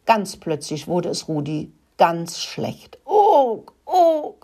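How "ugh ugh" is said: high, loud acted retch; two heaving "ugh" noises of throwing up. A narrator's reading. Rat Rudi suddenly feels sick, high hammed-up retching. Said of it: "musste sich Rudi übergeben".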